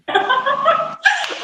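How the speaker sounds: high run of giggles; laughing. Real, not acted. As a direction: giggle at your own plug. Amused after her own plug.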